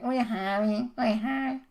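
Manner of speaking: high-pitched Scouse imitation; mock Scouse accent. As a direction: mimic a Scouse canteen girl's question. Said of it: "why are you talking with such a, like, acute".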